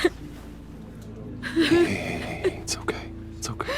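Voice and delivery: calm her down gently; soothing hush. Acted. Bruce comforts crying Tiffany.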